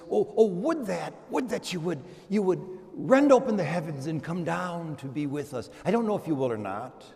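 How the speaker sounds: ordinary delivery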